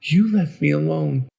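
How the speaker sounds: put-on high, loud child voice, bitter; hurt little boy crying out; anguished accusation. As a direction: accuse them like an abandoned boy. Boy accuses those who abandoned him.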